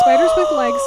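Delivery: high, loud cry of disgust; horrified shriek. Real, not acted. Telling her friend about the spiders, high horrified shriek. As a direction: shriek it out in horror. Repeats the words in horror.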